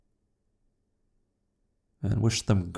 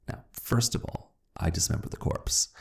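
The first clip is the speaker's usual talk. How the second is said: hushed confession; 7 dB quieter than usual. A narrator's reading. Murderer confiding the dismemberment, whispered. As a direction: breathe it like a guilty secret.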